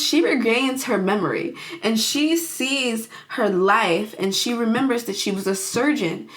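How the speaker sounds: usual speaking voice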